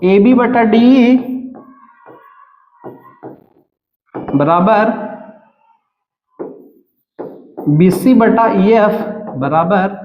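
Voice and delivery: drawn-out terms, long silences between; slow dictation. Real, not acted. Teacher writing out equal ratios, slow dictation. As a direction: dictate the equation term by term.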